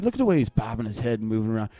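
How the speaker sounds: ordinary delivery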